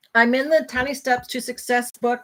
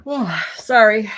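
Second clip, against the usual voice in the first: ordinary talk, nothing marked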